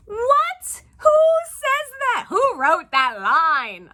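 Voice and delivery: high, loud disbelief; shocked outrage. Real, not acted. Outraged reaction to line.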